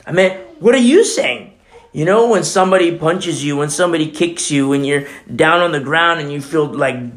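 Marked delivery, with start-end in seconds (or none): 0.1-1.5 exclaimed. Real, not acted. Preacher's rhetorical outburst.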